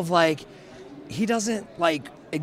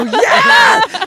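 very loud, high fan cheer; excited yell; 14 dB louder than usual. Retold or imitated. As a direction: blurt it out as a thrilled shout. Retold excited reply to idol.

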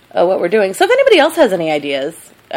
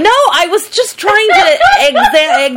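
high, loud, helpless laughing denial; laughing protest; 14 semitones higher, 5 dB louder, slower than usual. Real, not acted. Denying a dig at the southern accent, laughing hard. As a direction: protest loudly through real laughter.

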